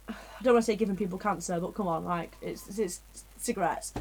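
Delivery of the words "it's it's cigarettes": normal talking voice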